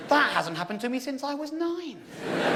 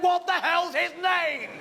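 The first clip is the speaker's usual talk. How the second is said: exasperated question; 5 semitones higher, slower than usual. Retold or imitated. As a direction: drag it out in disbelief.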